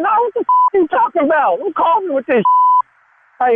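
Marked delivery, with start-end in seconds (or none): 0.1-2.4 voice getting high. Real, not acted. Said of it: "Why your voice getting high?".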